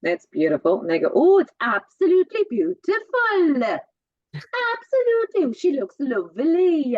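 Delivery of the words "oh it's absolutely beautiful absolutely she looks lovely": loud, high, swooping Welsh lilt; musical Welsh accent. Retold or imitated. Showing how Welsh relatives praise something, musical Welsh accent. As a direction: gush in a big sing-song Welsh accent. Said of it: "So it's very musical".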